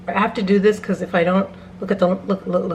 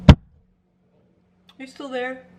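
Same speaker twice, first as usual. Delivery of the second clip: coaxing call; 5 semitones higher than usual. Acted. Coaxing someone out of closet.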